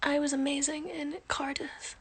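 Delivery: reading a comment in a whisper; hushed, breathy reading. Real, not acted.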